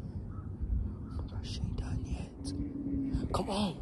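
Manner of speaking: slow hushed whisper. Real, not acted. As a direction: whisper it slowly. Whispering, dog still pooping.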